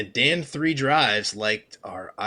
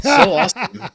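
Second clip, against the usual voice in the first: laughing; 9 semitones higher, 8 dB louder than usual. Real, not acted. Quote read laughing.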